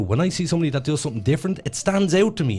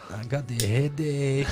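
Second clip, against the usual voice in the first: slow, soft put-on Mexican accent; 6 dB quieter, slower than usual. Co-host playing along with the impression, mock Mexican accent. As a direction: join in as a hammed-up slow Mexican. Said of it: "you do an impression there of, uh, an, a Mexican".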